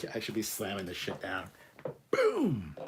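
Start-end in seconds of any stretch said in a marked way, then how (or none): 2.1-2.8 a high, punchy cry of triumph; celebratory exclamation; excited boom. Real, not acted. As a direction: slam the word down like the shot glass. Slamming down a rum shot.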